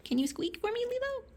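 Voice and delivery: rising high coaxing voice. Real, not acted. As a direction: coo at the pet sweetly. Coaxing the cat to squeak.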